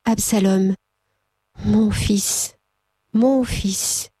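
a mild grieving tremor on each mon fils; restrained sorrow, not full sobbing; weeping lament. Acted. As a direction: lament for the lost son, understated. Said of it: "il se mit à pleurer".

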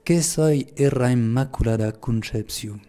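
slow, deliberate phrase in Lourdes patois. Shown as a demonstration. Priest quoting the Virgin's words to pilgrims, in slow patois. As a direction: recite it slowly in Pyrenean patois. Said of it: "je vais le dire en patois".

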